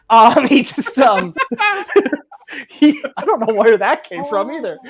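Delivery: laughing. Real, not acted. GM laughing at own voice.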